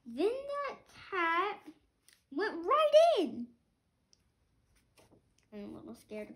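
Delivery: lively storybook read-aloud; reading to children. A narrator's reading. Parent reading a picture book to a child, lively. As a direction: read it like a bedtime book for kids.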